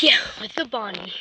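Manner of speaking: normal talking voice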